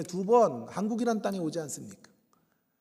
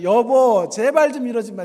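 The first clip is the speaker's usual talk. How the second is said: pleading with wife; 5 semitones higher, 12 dB louder than usual. Retold or imitated. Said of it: "그래서".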